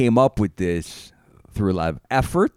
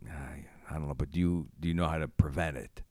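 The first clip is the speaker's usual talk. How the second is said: snake oil salesman; 6 semitones lower, faster than usual. Acted. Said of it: "You sound like a snake oil salesman".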